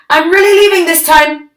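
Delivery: high, fast call. Acted. Lily announcing to her parents she's running away, calling out. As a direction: call it out as she runs off. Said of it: "she called".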